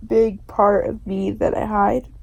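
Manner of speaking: ordinary delivery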